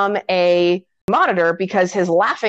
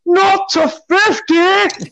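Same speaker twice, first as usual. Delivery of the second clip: high, drawn-out horrified cry; Count Rugen; 12 semitones higher, 4 dB louder, much slower than usual. Retold or imitated. Storyteller as count protesting at the death machine, alarmed cry. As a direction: cry it out slowly in mock alarm. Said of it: "And Count Rugen's like".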